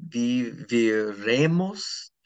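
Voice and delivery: slow, syllable by syllable "viviremos"; careful Spanish. Shown as a demonstration. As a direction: spell out "viviremos" slowly for students. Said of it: "will be".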